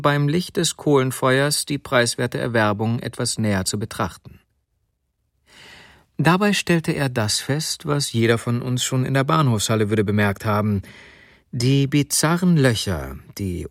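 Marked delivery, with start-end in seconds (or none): none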